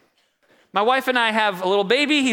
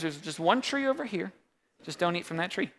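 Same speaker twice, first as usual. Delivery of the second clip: soft, low-key voicing of God's warning; hushed God voice; 4 semitones lower, 9 dB quieter than usual. Preacher voicing God telling man not to eat, hushed. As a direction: say it gently, like a quiet warning.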